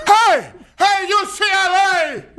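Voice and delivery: put-on high, drawn-out Cuban-accented holler; Yoel Romero. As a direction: call out loudly in a mock Cuban accent. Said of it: "in this heavy accent".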